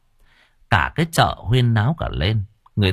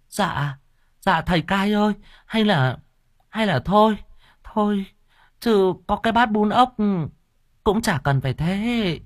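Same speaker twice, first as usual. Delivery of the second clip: begging seller; 11 semitones higher than usual. Acted. Noodle seller begging the official to drop it, high and anxious. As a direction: beg him to let it go, high and small.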